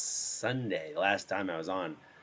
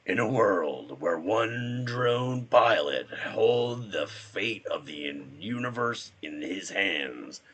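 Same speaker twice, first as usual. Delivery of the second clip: slow, epic trailer voice; movie announcer; 2 semitones higher, 5 dB louder, much slower than usual. Acted. Drone pilot narrating his own trailer, movie announcer voice. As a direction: open big and loud, drawing out every phrase. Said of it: "That movie voice guy".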